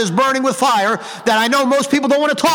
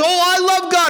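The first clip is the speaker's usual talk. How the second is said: higher-pitched imitation of a gushing believer; mock emphatic devotion; 7 semitones higher than usual. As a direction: mimic their overemphatic profession of love. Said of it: "they say it so emphatically".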